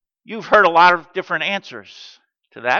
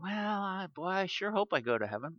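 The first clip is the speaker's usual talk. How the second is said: unsure hoping; 14 dB quieter, faster than usual. Retold or imitated. Imitating an unsure believer.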